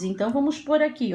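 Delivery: normal talking voice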